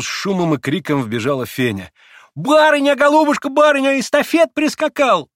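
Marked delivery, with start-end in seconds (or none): 2.3-5.3 very high, loud excited cries; shrieking, happy maid out of breath; merry breathless exclaiming. A narrator's reading. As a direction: burst in with joyful news. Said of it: "восклицала она весело и запыхавшись".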